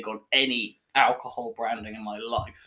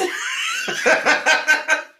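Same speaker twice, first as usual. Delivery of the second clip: high disgusted laugh; 7 dB louder than usual. Real, not acted. Reacting to foul beer.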